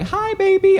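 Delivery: put-on high, cooing "Hi, baby."; high baby greeting. Shown as a demonstration. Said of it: "Most people go high".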